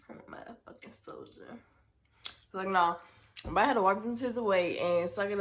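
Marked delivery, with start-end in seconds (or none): 0.1-1.6 breathy whispered boast; whispered aside. Real, not acted. Whispering to viewers that she toughed it out.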